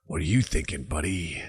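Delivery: lightly voiced wary stranger sizing him up; suspicious local.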